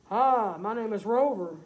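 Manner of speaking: loud friendly dog; farm dog voice. Acted. Farm dog Rover greeting blue Tom, loud hearty voice. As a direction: bark a big hello like a farm dog.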